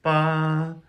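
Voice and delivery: BA held high and level, sung out; first tone. Shown as a demonstration. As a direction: sing BA on one flat high pitch. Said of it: "it is a flat, high pitch tone".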